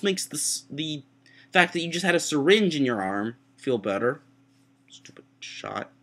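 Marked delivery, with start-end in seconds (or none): 4.9-5.9 whispered, slow grumble; barely voiced little gripe; grumbling aside. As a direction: mutter it sulkily under your breath. Grumbling aside after the rant.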